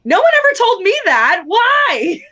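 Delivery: high, loud disbelief; real astonishment. Real, not acted. Friend hearing of the shell taboo, astonished. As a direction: exclaim it high, demanding an explanation.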